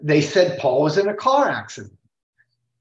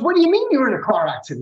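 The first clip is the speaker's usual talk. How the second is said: higher, fast bewildered question; startled question; 6 semitones higher, much faster than usual. Retold or imitated. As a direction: blurt it out in disbelief. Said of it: "And Gene goes".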